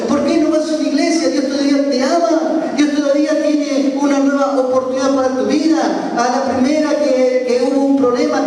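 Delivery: high-pitched, insistent pleading; urgent exhortation. Retold or imitated. Pastor urges the robber back to church, urgent exhortation.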